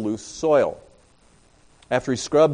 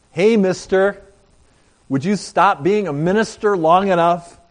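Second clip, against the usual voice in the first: put-on kids calling out; 6 semitones higher, 4 dB louder than usual. Preacher repeats the kids' call.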